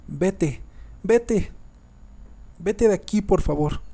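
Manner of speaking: high, loud narrated cries of panic; frantic screaming. A narrator's reading. Terrified girl orders the dead child away, frantic shouting. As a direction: cry out desperately, pleading at the end. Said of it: "se puso a gritar como loca".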